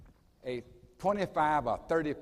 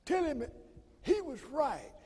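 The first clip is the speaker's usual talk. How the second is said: a much higher, softer imitation of a friend's voice; imitating Sterling; 11 semitones higher, 3 dB quieter than usual. Retelling the friend's phone calls, in a much higher, softer mimicking voice. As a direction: pitch way up and soft, mimicking the friend. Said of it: "It's the way he talked".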